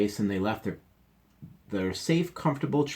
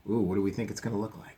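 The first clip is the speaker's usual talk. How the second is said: usual speaking voice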